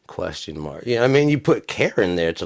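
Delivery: normal talking voice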